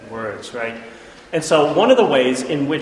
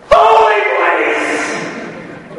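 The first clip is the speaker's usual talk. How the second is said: "Holy place!" cried out much higher and louder, drawn out slowly; exclaimed shout; 8 dB louder, much slower than usual. Shown as a demonstration. Teacher shows the Hebrew exclamation point with a long, high, loud cry. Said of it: "This is like, exclamation point".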